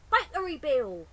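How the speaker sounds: put-on loud, high little child's call; baby calling. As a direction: call out proudly. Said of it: "says the baby, perfectly".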